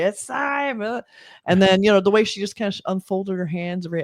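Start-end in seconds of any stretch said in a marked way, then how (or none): 0.0-1.0 higher, mock spooky "it's time"; cheesy horror-movie announcement; scary voice. Host mocking the expected scary voice, higher spooky. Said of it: "the scary voice to say".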